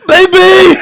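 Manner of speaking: high, loud frantic yell; panicked shout. Retold or imitated. Boyfriend yells at his collapsed girlfriend, panicked shout. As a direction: bellow it like she might be dead.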